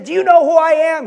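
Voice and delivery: high, loud, offended demand; indignant outburst. Retold or imitated. TV executive angrily demanding recognition from the visitor, loud indignant shout. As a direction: puff up and bark it out indignantly. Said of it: "the man got very upset".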